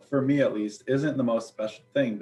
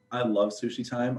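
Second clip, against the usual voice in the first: ordinary talk, nothing marked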